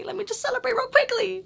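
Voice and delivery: very high-pitched celebratory singsong; giddy squeal. Real, not acted. Vlogger celebrating good results, giddy high squeal.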